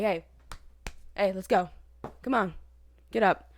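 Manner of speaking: loud, separate commands with gaps between; brusque wicked nurse. Retold or imitated. Mean night nurse storming in to wake patient, brusque. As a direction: imitate a nurse storming in to rouse someone. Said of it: "Freaking stormed in there".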